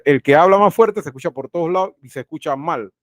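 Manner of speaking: usual speaking voice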